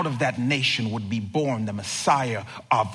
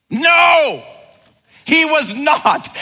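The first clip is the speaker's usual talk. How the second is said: loud, high, punchy no; booming high denial; emphatic shout; 14 semitones higher, 10 dB louder, slower than usual. Real, not acted. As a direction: shout down the idea.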